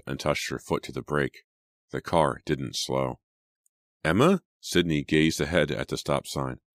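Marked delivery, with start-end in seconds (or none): 4.0-4.4 high, louder, rising call; anxious questioning call; alarmed question. A narrator's reading. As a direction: call the name in rising worry. Alarmed as car won't slow.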